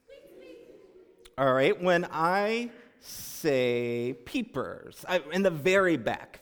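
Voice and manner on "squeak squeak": tiny high whispered squeaks; mouse squeak. Shown as a demonstration. Leader showing the mice group, tiny squeaks. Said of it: "can you go squeak".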